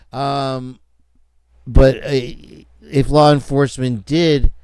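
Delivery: ordinary talk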